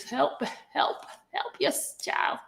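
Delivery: weak, voiceless plea between coughs; choking. Real, not acted. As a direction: gasp for help while really choking. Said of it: "about to choke over here".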